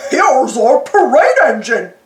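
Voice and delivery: a hint of grinning cartoon dope; slow, proud show-off; Goofy voice. Goofy shows the boys the old truck: slow, grinning pride. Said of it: "Goofy grinned".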